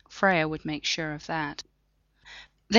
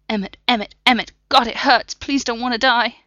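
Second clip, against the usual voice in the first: loud, high cries of pain and fear; agonized pleading; 6 semitones higher, 7 dB louder than usual. Acted. Dying Block crying out to Freya in agony. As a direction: cry out through the pain.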